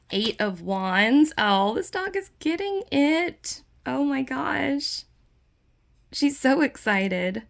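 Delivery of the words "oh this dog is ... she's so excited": high, drawn-out delighted cooing; delighted gushing. Real, not acted. Reacting to an excited dog on a card, delighted gushing. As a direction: gush slowly in a much higher voice.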